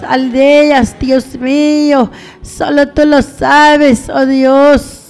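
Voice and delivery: usual speaking voice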